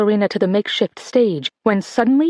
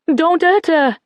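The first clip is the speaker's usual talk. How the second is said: scream; 8 semitones higher, slower than usual. A narrator's reading. Mother begs soldiers to spare daughter, high scream. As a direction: scream to protect her child. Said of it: "Mum screamed".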